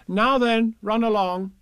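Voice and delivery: high, loud, brisk send-off; motherly Kanga. Acted. Kanga sends the youngsters out, high motherly order.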